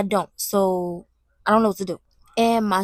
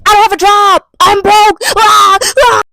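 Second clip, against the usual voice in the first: loud, high wailing shout; mock-despairing yell into a wail; hammed-up meltdown; 8 semitones higher, 12 dB louder, slower than usual. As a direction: shout it like a comic breakdown. Hammed-up meltdown over problem list.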